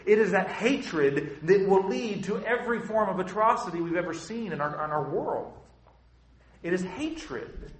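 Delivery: fervent preacher's build; forceful preaching. Acted. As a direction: press the warning home to the congregation.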